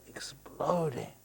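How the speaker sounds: hushed, breathy whisper for the big moment; whispered for drama. Acted. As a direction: drop to a secret whisper. Dramatic pause in cosmic story.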